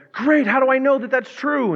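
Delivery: hammed-up sarcastic question; skeptical Bartimaeus. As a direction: play the doubter, pitch way up.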